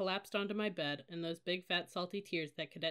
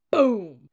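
sudden booming burst; explosive boom; 4 semitones higher, 18 dB louder than usual. A narrator's reading. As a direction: imitate a door crashing open. Door flung open, sound imitated.

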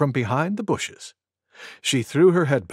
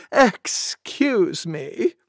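drawn-out, prim excuse me; slow polite apology; 3 semitones higher, much slower than usual. Acted. Deer apologizes after a huge sneeze, slow and polite. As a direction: stretch each syllable politely.

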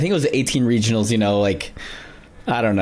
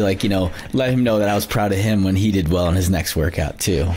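usual speaking voice

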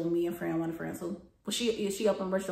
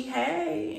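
high-pitched mimicked "Hey"; lightly mocking high hello; retold greeting; 9 semitones higher, 4 dB louder than usual. Voices the coworker's greeting in story.

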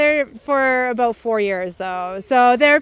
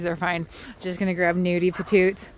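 ordinary delivery

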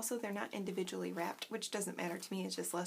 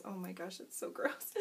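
usual speaking voice

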